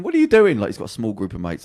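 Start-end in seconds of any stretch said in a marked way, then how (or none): 0.0-0.6 high, loud startled question; retold alarm, pitched right up and loud; incredulous mate. Mate calling after him as he runs into the crowd, incredulous.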